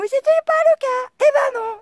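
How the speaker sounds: loud, very high exasperated yell; shouted denial. Real, not acted. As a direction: stretch it out in a high-pitched shout.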